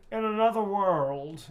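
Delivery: drawn-out, high and loud catchphrase; character quote. Acted. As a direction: belt it out slowly in a high voice. Voicing the inside-joke quote for a fan.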